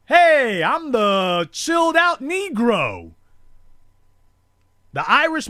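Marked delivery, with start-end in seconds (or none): 0.1-3.1 laid-back boast. Retold or imitated.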